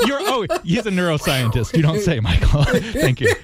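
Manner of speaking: high-pitched, amused jab through laughter; laughing mock surprise; laughing tease. Real, not acted. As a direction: tease him while laughing. Laughing at the dense scientific email.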